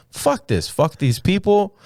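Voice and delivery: high-pitched devil-may-care declaration; mock defiance. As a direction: voice his who-cares bravado. Voices the comic's freedom.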